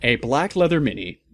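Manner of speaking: ordinary delivery, nothing marked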